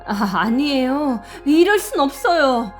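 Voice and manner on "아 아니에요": usual speaking voice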